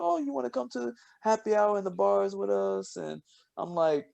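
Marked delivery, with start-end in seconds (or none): 0.0-3.0 high mock undergrad voice; imitating flirty students.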